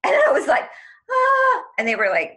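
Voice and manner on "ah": loud, very high "ah"; high gasp. Retold or imitated. Her reacting to the voice, loud high gasp.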